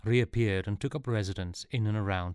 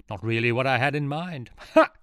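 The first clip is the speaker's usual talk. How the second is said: louder, laughing at own joke; a hint of self-pleased villain; villain enjoying his pun; smug joke; 5 semitones higher, 8 dB louder than usual. A narrator's reading. Villain jokes.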